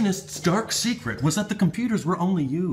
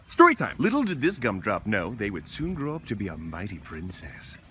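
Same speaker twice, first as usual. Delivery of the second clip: usual speaking voice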